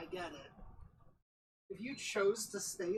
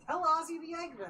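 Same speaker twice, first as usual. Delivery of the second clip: high character voice; 9 semitones higher, 6 dB louder than usual. Acted. Playing along in put-on voice.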